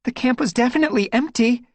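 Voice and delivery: high, out of breath; panting. A narrator's reading. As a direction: pant the words. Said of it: "Squirrelpaw panted".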